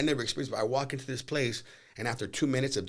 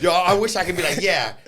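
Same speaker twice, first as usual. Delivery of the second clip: wishful bravado; 7 semitones higher, 11 dB louder than usual. Retold or imitated. Scared, retelling reaction.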